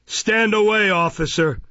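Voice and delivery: high, loud order; Mister Fang, crying out. A narrator's reading. Magistrate ordering his officer off the prisoner, crying out. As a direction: call out a sharp command, pitch jumping up. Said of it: "cried Fang".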